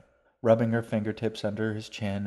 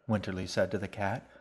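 plain reading voice

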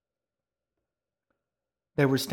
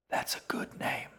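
put-on soft, voiceless old woman's whisper; 5 dB quieter than usual. A narrator's reading. Old woman approves of the boy's name, whispering. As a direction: whisper it softly to the boy. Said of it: "Lima whispered".

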